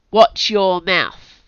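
slow, loud sharp warning; snapping. A narrator's reading. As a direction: snap it out as a rebuke. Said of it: "snapped the Sarge".

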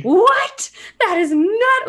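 high, loud outraged squawk; mock French outrage. Parisian friends screaming about the accent, mock outrage. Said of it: "they were so mad in, like, classic French fashion".

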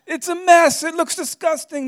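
shrill high shout of alarm; panicked wife. Retold or imitated. Wife panicking that a buyer comes in, high shout. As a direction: panic about the messy house. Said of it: "She's like".

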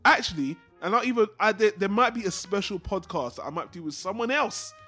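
normal talking voice

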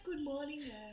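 high, excited impression of a friend; imitating Maddie. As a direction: put on a bright voice like Maddie. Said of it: "I was trying to sound like Maddie".

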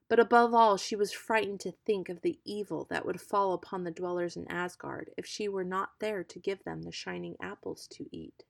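plain reading voice

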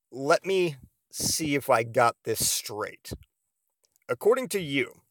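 slow, measured mock disbelief; deliberate recap. Boyfriend checking he got her accusation straight, deliberate disbelief. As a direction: spell it out with long pauses.

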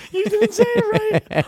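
laughing through the words; cracking up while talking. Real, not acted.

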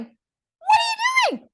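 very high, drawn-out holler; incredulous calling out. Retold or imitated. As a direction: mimic a husband yelling in disbelief. Husband calls after the rider.

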